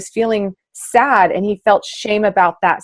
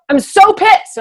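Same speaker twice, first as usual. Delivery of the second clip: mock angry outburst; 13 semitones higher, 7 dB louder, slower than usual. Retells her own sudden anger.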